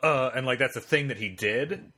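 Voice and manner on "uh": lightly mimicked host's stalling fillers; imitated verbal pauses.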